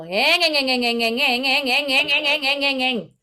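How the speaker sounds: eng eng repeated like a motorcycle engine; twang eng exercise. Shown as a demonstration. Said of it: "like driving with the motorcycle".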